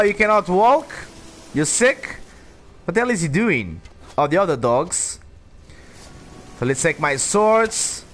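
ordinary talk

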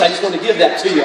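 ordinary talk, nothing marked

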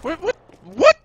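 high, loud startled "what?"; shocked shout. Real, not acted. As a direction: yelp it in disbelief. Sudden shock at something on stream.

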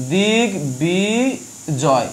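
দিগ্বিজয় very slowly as দিগ-বি-জয়, both g and b sounded; syllable-by-syllable pronunciation. Shown as a demonstration. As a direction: draw out each syllable of দিগ্বিজয়, keeping the গ and ব. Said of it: "দুজনের উচ্চারণ হবে". (দিগ্বিজয়: দিগ-বি-জয়, টেনে টেনে ভেঙে উচ্চারণ)

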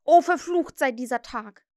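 loud cursing cry; Starkey shouting. Acted. Starkey reacts to the news, loud cursing shout. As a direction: curse the day aloud. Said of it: "schrie Starkey".

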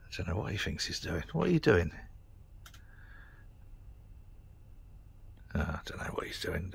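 soft hushed wondering about the man; whisper. Real, not acted. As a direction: keep it under your breath. Man outside approaching.

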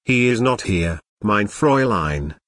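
usual speaking voice

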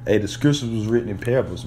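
ordinary talk

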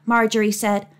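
usual speaking voice

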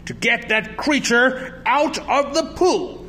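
high, emphatic coach's order; angry cry. A narrator's reading. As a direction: cry out an exasperated demand. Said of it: "he cried".

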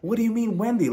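high-pitched mock-shocked question; imitated shock. Imitating a shocked woman questioning the blame, high and incredulous. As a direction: act taken aback, pitch lifted high. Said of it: "Even Wendy looked shocked".

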